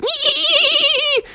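very high squeaky bat screech; a tiny bat's shrill chirp; bat squeak. Acted. Bats squeak at the friends, very high.

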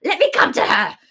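high, loud furious demand. Retold or imitated. Hermia straining to attack Helena, loud and high. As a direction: shout to be let loose. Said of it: "Why will you suffer her".

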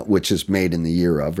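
ordinary delivery

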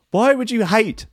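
high-pitched incredulous question. Real, not acted. Reader questioning a bizarre complaint about the English, incredulous. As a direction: voice leaps up in bafflement.

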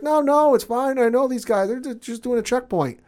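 high, bright, loud reassurance in a put-on voice; cheery driver. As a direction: play the overfriendly pro-Empire driver. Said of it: "And he's like".

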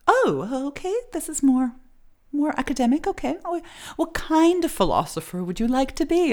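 high-pitched mock-prim English counselor; snooty English accent. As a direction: grudgingly approve in a put-on snooty English accent. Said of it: "that sort of snooty accent".

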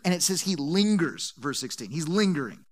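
ordinary talk

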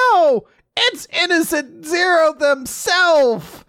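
high, loud mock outburst; dramatic alarmed cry. Host retelling a character's big reveal, high mock-alarmed cry. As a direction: draw it out in high, theatrical alarm.

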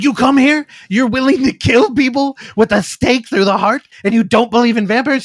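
mock-exasperated, like he can't believe it; incredulous demand. Vampire hunter grills the hired help, loud and incredulous.

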